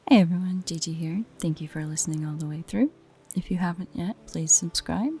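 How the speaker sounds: ordinary talk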